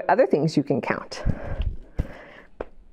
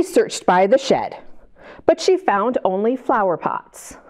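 put-on reading to children. A narrator's reading. Reads picture book aloud.

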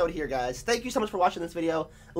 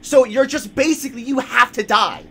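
loud, high, exasperated yelling at the game; frustrated shout; 6 semitones higher, 7 dB louder than usual. Real, not acted. Gamer yelling at immortal zombies, loud and exasperated. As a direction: vent at the screen, voice raised high.

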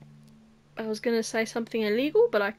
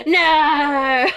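laughing no; 4 semitones higher, 6 dB louder than usual. Real, not acted. Laughing scream.

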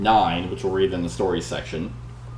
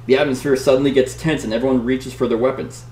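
dramatic read-aloud narration; story narration; 5 semitones higher, 3 dB louder than usual. Acted. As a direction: read it like an adventure gamebook. Reads the gamebook story aloud.

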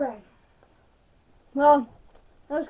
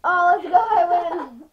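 excited victory shout; 4 semitones higher, 9 dB louder than usual. Real, not acted. Wins sour candy challenge.

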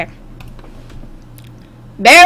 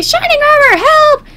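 very high, hammed-up damsel call; cry for help; 14 semitones higher than usual. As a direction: call for rescue in a high squeal. Acts pony calling for help.

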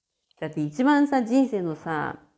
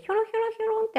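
much higher, light and wispy; high thin voice; much faster than usual. Shown as a demonstration. Mocking how Japanese women talk at offices, high and thin. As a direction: mimic a meek high-pitched tone. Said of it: "高い声で".

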